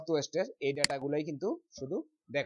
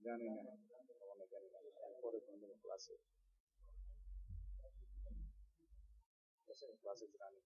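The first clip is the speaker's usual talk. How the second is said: whispering on the phone; 5 semitones lower, 18 dB quieter than usual. Real, not acted. Takes phone call during class.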